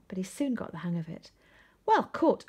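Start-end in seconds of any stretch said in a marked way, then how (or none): 1.8-2.4 much higher, louder praise; cheering cry. A narrator's reading. Ben's mum cheers Arthur's catch, loud and high. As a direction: cheer him on. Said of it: "cried Ben's mum".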